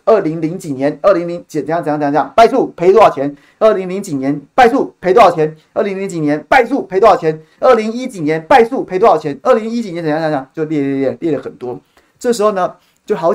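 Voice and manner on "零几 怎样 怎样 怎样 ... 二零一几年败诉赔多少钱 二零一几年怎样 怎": loud, higher-pitched repetitive recital; reciting a list. Retold or imitated. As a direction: hammer out each item of the list, punching 败诉. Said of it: "开始把这些资料就开始念".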